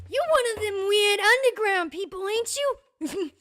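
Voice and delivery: high, loud nosy challenge; hammed-up shopkeeper, loud and forward; brash shopkeeper. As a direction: size them up out loud, pitched high. Shopkeeper challenges a player character.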